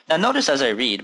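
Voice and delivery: ordinary talk, nothing marked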